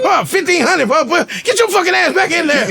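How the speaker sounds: high laughing disbelief; mock shocked outrage. At the pet store he sees the price tag, high shocked laughing outrage.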